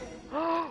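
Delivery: sharp delighted gasp; excited gasp. Real, not acted. Sees cute character.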